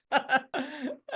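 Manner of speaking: high bright burst of laughter; laughing at own punchline. Real, not acted. Speaker laughing at his own parable's punchline. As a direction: crack up at the joke.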